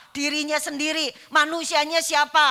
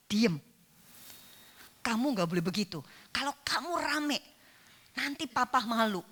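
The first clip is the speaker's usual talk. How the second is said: teguran berbisik tegas; 3 semitones lower, 7 dB quieter than usual. Retold or imitated. Ibu menegur anak di gereja.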